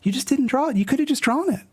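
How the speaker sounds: high, squeaky comic sidekick voice; squeaky character voice. Acted. Reading a comic, a small character complains, high and squeaky. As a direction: pitch it way up like a cartoon kid.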